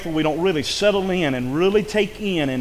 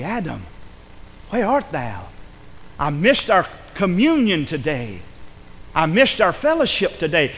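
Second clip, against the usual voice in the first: loving call. Retold or imitated. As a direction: call gently, like a father missing his son. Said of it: "he cried out lovingly".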